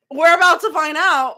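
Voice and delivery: high, loud and thrilled; can barely contain the excitement; excited. Real, not acted. Excited about upcoming chapter.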